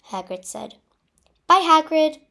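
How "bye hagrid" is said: high, loud goodbye yell. A narrator's reading. Girl to departing Hagrid, yelling to be heard. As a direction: yell so it carries. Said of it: "I yelled so he could hear".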